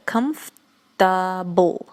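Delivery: very slow comfortable, three syllables; slow model word. Shown as a demonstration. Teacher slowly modelling comfortable for Russian learners. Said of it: "вот этот не произносится".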